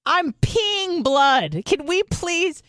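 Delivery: high, strained-patience mock protest; exasperated plea. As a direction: plead in comic desperation, pitch way up. Retold desperate plea to nurse.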